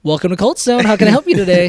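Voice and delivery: bright, polite service greeting; customer service voice. Retold or imitated. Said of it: "your customer service voice".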